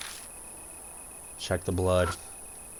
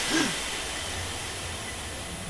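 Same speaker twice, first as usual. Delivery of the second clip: gasp; 10 dB quieter than usual. Real, not acted. Startled by the game.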